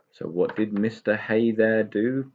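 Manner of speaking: ordinary delivery, nothing marked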